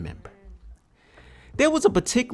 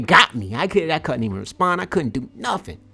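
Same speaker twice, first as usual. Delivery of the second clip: ordinary talk, nothing marked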